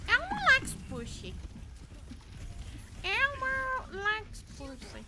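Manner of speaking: very high puppet voice; Elmo. Retold or imitated. Streamer finally doing Elmo for the audience, high voice. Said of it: "Do you want me to do it?".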